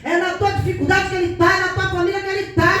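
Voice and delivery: loud, driving preaching cadence; forceful exhortation. Real, not acted. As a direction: hammer each declaration home to the congregation.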